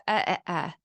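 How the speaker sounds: "uh, uh, uh" as bare short vowels; consonants left off. Shown as a demonstration. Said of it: "they didn't say the T, D, or N".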